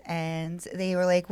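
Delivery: ordinary talk, nothing marked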